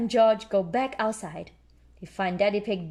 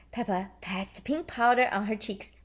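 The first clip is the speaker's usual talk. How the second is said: usual speaking voice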